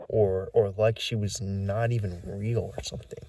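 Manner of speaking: ordinary delivery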